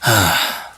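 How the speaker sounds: long tired sigh; weary sigh. Acted. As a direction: let out a heavy sigh.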